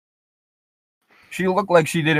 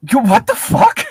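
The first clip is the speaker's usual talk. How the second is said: high, loud what the fuck; baffled outburst; 13 semitones higher, 5 dB louder than usual. Real, not acted. Player baffled by the ICE remark, loud and high. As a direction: react in total disbelief.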